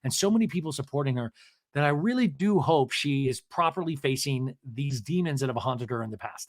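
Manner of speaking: normal talking voice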